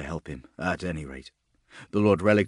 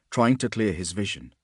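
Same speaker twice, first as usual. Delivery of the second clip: usual speaking voice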